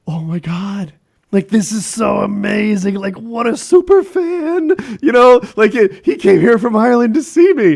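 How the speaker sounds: high, loud hammed-up gushing; mock starstruck excitement. Host retelling his thrill at a superfan from Ireland, hammed-up excitement. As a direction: retell it as thrilled, squealing amazement.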